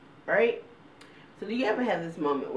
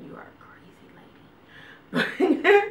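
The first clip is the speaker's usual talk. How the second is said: slow, high whisper of a doctor's verdict; mock doctor; 12 semitones higher, 5 dB louder, much slower than usual. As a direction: whisper it like a teasing diagnosis. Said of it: "always told me".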